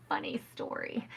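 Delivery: a quick breathy whisper, half laughing; whispered aside. Real, not acted. To the listeners before a funny story, whispered. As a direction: lean in and whisper it like a secret.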